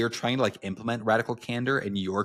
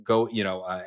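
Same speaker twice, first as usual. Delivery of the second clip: ordinary talk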